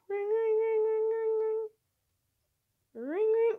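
sung-out high ring ring like a phone; mock phone ring. Gamer mimicking the phone ringing in the office, high sing-song.

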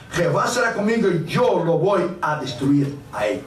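ordinary talk

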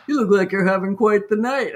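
quick, light, wry remark; dry, amused understatement; amused doorman. Retold or imitated. Doorman greeting a soaked rider, wry amused tone. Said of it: "he's like".

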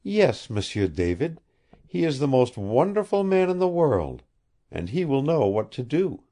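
put-on earnest high young woman, pleading and honest; lightly voiced heroine, innocent and earnest; young woman's voice. A narrator's reading. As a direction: speak like a puzzled, sincere girl.

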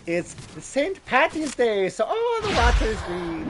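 terrible hammed-up Irish accent. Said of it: "My terrible Irish impression".

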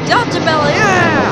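loud, high burst of delight; thrilled yell at the plane overhead; excited cheer. Real, not acted. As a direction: cheer the shot you finally got. Finally gets Delta belly shot.

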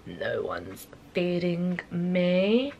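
annoyed whine. Real, not acted. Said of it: "I'm getting a bit annoyed".

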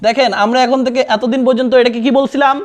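ordinary delivery

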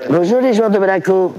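high, excited hello to star players; calling out greeting. Real, not acted. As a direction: hail them across the room. Calls out to footballers.

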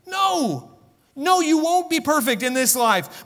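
high-pitched, emphatic exhortation; impassioned preaching. Real, not acted. Preacher rebukes the excuse.

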